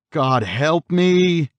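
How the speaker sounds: high, loud, drawn-out plea; terrified scream. A narrator's reading. Man facing the hooded figure in his nightmare, screaming. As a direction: scream slowly for help, pitch soaring. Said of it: "he screamed".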